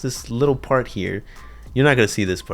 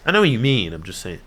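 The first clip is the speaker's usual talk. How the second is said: ordinary talk, nothing marked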